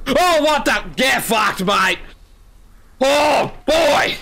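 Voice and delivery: high frightened shout; startled shout. Real, not acted. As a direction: yelp it out in real fright. Startled by jump scare.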